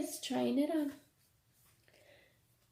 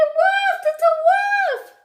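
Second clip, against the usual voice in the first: much higher, much louder panicked yell; frantic shout; 6 dB louder than usual. Acted. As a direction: cry the alarm at the top of your voice. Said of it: "she shouted".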